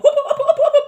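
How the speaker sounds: high loud whoop whoop calls, laughing; mock dog-shooing. Mocking how the woman shooed her like a dog, high loud whoops. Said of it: "talk to the person like a dog".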